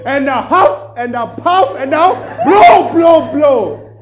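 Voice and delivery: big storybook fox bellow; huffing fox shout. Acted. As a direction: blow the house down, climbing each line. Fox tries again.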